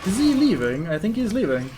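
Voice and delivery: real alarm that the target escapes; alarmed excitement. Real, not acted. Player watches the troll wander off, loud and alarmed.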